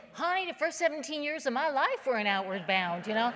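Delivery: sassy inner thought. Retold or imitated. Telling the audience what he thought, sassy and loud. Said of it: "I'm thinking".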